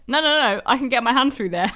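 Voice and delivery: eager insistence. Retold or imitated. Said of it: "I was literally like".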